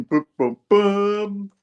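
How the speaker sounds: mimicked comic-book impact; imitated booms. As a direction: punch out mock booms, last one held. Imitated sound effect in retelling.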